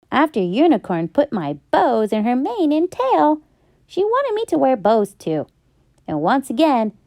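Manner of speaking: ordinary delivery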